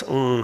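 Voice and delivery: ng said as the NG sound at the end of sing; velar nasal ng. Shown as a demonstration. Lecturer demonstrates the velar nasal ng as in sing. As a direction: hum ng from the back of the mouth, like in sing. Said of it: "is the N G of sing".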